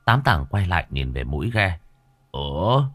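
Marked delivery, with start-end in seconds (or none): 2.3-2.8 put-on drunken bafflement. A narrator's reading.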